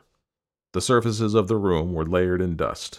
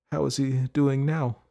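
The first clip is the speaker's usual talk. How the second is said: light, higher, gentle question; soft, tired mother; 7 semitones higher than usual. A narrator's reading. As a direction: ask after the friend softly. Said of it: "It was soft and tired and light".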